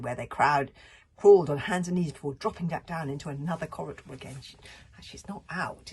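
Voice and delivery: usual speaking voice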